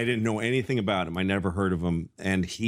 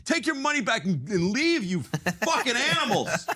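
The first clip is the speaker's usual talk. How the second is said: mock angry rant; 14 semitones higher than usual. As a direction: hurl the insult like a furious comic. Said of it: "he'd throw money at people".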